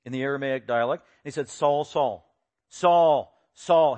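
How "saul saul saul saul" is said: name called out twice, loud and falling; emphatic call. Retold or imitated. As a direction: call "Saul" firmly, letting each one drop. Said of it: "So it is emphatic".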